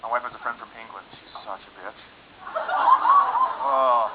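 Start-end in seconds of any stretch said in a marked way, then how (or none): 2.6-4.1 squealed oh. Acted. Squeal before wife's friend.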